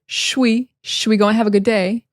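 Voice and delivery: "should we" blended to "shwi"; reduced "should we". Shown as a demonstration. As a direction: squeeze "should we" into one syllable. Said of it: "I thought it was, "Should we?".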